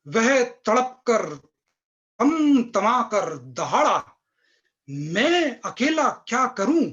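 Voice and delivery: slow declamation with big rises and falls; dramatic poetry recitation. Shown as a demonstration. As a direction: recite heroic Hindi verse, building from hushed opening to loud roar. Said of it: "अब देखिए हम intonation की practice कैसे करते हैं".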